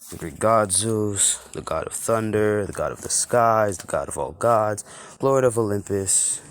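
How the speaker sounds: Zeus declaring his titles; grand god voice. Acted. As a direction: announce yourself like a mighty god. God Zeus introduces himself.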